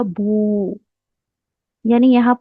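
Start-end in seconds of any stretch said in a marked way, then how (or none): 0.0-0.8 "बू" with a long "ऊ"; long vowel demo. Shown as a demonstration. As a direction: draw out the vowel of "बू". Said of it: "अब यह बन जाएगा". ("बू" को लंबा खींचकर बोलना)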